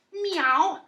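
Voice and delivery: high, soft meowing; cat meow. Shown as a demonstration. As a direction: sound like a little cat. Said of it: "what noise does a cat make".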